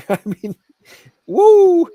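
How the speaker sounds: incredulous whoop; disbelieving whoop. Real, not acted. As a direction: laugh then loud high woo. Exclamation at huge sum.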